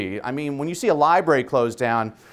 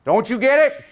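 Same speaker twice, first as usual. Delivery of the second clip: high, raised oratorical call; boy preacher like Martin Luther King Junior; 11 semitones higher, 5 dB louder than usual. Retold or imitated. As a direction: imitate a child opening a civil-rights speech. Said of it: "Howard spoke like Martin Luther King Junior".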